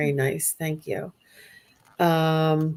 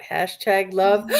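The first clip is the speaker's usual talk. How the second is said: usual speaking voice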